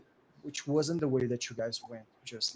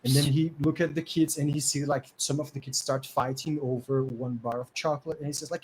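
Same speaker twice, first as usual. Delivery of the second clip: usual speaking voice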